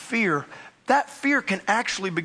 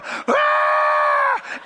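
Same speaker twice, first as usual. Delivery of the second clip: very high, loud sustained scream; blood-curdling scream; 5 dB louder than usual. Retold or imitated. Passenger as the plane banks, imitated blood-curdling scream. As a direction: imitate a terrified shriek. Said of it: "blood cur".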